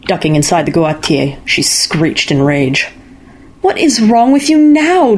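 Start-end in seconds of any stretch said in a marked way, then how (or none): 3.6-5.2 high, slow, exasperated mother; mother demanding an answer from Aino again, high and slow. A narrator's reading. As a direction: demand it, fed up with her daughter. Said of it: "demanded her mother".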